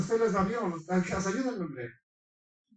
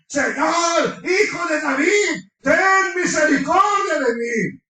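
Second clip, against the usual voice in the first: loud begging cry, pitched higher, slow on the last line; imitating her crying out; 6 semitones higher, 4 dB louder, slower than usual. Preacher mimicking the woman shouting after the disciples, loud pleading cry. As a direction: shout the plea like the woman trailing behind them. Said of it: "grita y grita esa mujer ahí".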